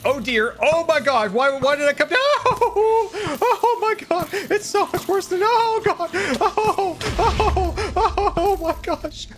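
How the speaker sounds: high, frantic panic; panicked. Real, not acted. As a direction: wail in a high, panicked voice as danger closes in. Panicked reaction to game danger.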